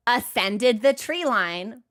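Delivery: ordinary talk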